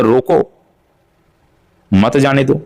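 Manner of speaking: रोको, a clear break, then मत जाने दो together; pause after रोको. Shown as a demonstration. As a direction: stop after रोको, keep मत joined to जाने दो. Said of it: "दोनों का अर्थ बदल जाएगा".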